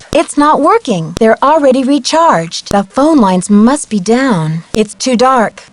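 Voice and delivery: ordinary talk, nothing marked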